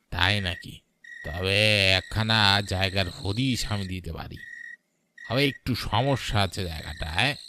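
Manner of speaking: a lightly altered village shopkeeper, sly and hesitant; lightly voiced shopkeeper hinting at a catch.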